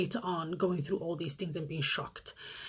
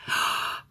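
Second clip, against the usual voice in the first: gasp. Real, not acted. Shocked aside to viewers.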